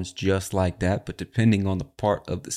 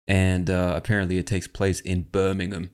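usual speaking voice

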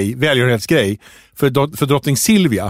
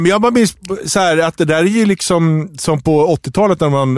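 ordinary talk

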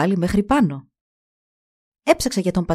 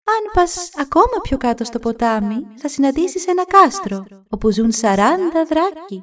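much higher, eerie voice; third goddess foretelling; 8 semitones higher than usual. Acted. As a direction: foretell the dragons like a spirit.

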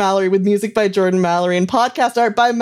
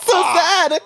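mock crying; 8 semitones higher than usual. Mock mourning the dying host.

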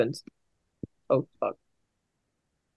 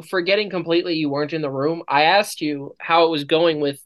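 ordinary delivery

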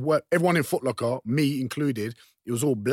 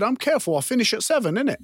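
high, defiant retold comeback; heated; 9 semitones higher than usual. Worker refusing to back down to a customer, heated. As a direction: square up with the voice pitched up. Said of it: "then I got heated".

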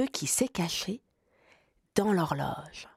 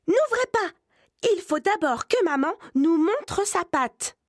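very high, louder little voice begging; youngest kid pleading; 10 semitones higher, 4 dB louder than usual. A narrator's reading. Littlest kid begs brothers not to open, high pleading. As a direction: plead like the tiniest goat. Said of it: "le plus petit biquet supplie".

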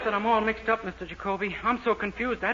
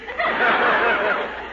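laugh. Acted. [name] laughs at his own joke.

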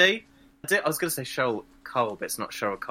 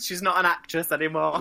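ordinary delivery

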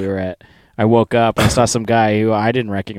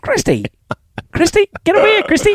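much higher, loud, insistent calling of a name; 3 dB louder than usual. Retold or imitated. A guy in the hostel calling after Christy, loud and insistent. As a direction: keep calling her name across the bar.

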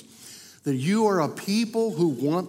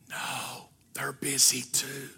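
put-on 1 child's hush; 4 semitones lower, 9 dB quieter, slower than usual. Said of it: "And the little voice said".

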